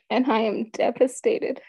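real tearful, strained high voice; choked up. Real, not acted. Mother recalling her denied appeal, choked up. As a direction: struggle to speak through rising tears. Recounting the lost appeal, devastated.

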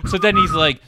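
normal talking voice

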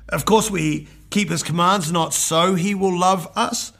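normal talking voice

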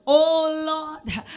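fervent prayer. Acted. Loud prayer of mercy.

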